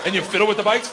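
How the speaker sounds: ordinary talk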